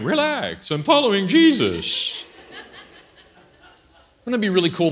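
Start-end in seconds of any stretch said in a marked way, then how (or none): 0.0-2.2 high, drawn-out mock reassurance; mock serenity. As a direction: stretch it out like an absurdly relaxed believer. Mocking calm in a head-on collision.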